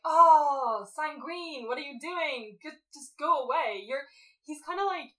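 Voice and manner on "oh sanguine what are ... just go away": higher, exasperated groan at a pest; weary shooing away. Retold or imitated. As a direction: wave him off, fed up. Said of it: "I'm like".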